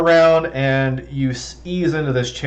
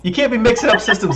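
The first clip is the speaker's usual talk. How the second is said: laughing; 10 semitones higher than usual. Real, not acted. GM laughing at player joke.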